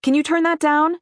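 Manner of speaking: loud, high call to the couch; calling across the room. A narrator's reading. Mom calls to daughter on the couch, loud. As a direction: call out to get her daughter's attention. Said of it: "she called to the couch".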